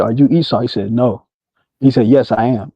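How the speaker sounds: normal talking voice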